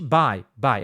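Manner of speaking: "Buy, buy" mais grave, com ditongo "ai"; pronúncia correta. Shown as a demonstration. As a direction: repete "Buy, buy" devagar como modelo. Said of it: "você aprende a falar corretamente". ("Buy" pronunciado certo, "bai")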